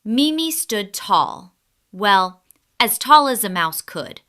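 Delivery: usual speaking voice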